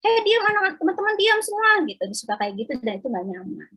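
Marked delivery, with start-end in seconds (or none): 0.0-1.7 much higher, louder, imitating a bossy classmate playing teacher; mock bossy teacher voice; bossy teacher impression. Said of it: "suka nyuruh-nyuruh kayak guru gitu".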